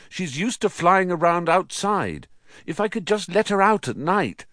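high, pleading explanation; explaining. A narrator's reading. Nephew pleading to let his owl out, explaining. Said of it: "to explain".

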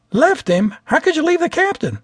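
as if you can't believe it; shocked disbelief. A narrator's reading. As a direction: attendant aghast that they left the captain. Attendant shocked pilot left behind.

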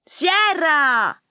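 high, loud calling scream; mother's shriek. A narrator's reading. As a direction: shriek your daughter's name. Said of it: "strillò Maria". (strillo della madre)

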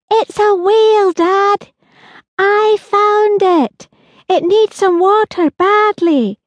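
put-on high, slow girl's voice, eager; a child proudly showing her find; excited little girl. Holly tells dad about whale.

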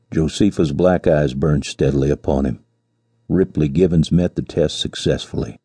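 ordinary delivery, nothing marked

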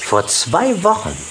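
ordinary delivery, nothing marked

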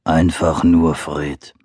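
low slow bear voice; growling bear. Acted. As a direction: rumble it like a big bear. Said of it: "sagte Fred".

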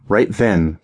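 ordinary delivery

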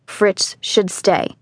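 "Fritz should stay" in slow, separated words; slow insistence. A narrator's reading. Father overrules mother about the son staying, slow insistence. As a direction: insist firmly, word by word.

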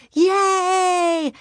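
high, loud cheering yay; shout. Acted. Class cheering for early closing, happy shout. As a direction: cheer like the whole class at once. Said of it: "everybody shouted".

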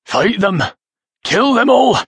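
high-pitched, impassioned exhortation; fierce urging. A narrator's reading. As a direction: cry out your last orders to your son. Wounded father urges son to fight.

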